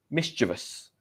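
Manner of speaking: "mischievous" in three syllables, stressed on the first; correct pronunciation. Shown as a demonstration. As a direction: say "mischievous" as MIS-chuh-vus, no "ee". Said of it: "Not mischievous".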